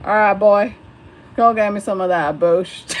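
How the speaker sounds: loud mock hillbilly twang, calling out; hammed-up Southern hillbilly ordering a beer. Streamer trying a hillbilly accent to order beer, loud mock twang. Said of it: "with the hillbilly twang".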